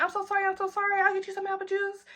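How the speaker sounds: high, soft sorry-sorry voice; mock apology. Friend fussing over spilled juice, high and soft. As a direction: gush apologies in a small high voice.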